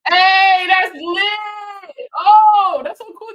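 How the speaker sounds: high, loud delighted shout; excited shout. Real, not acted. Thanking a viewer for a gift, loud and thrilled. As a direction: burst out in thrilled surprise.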